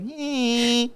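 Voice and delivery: high squeaky put-on "eh"; grossed-out groan. Cringing at her old Kmart photo.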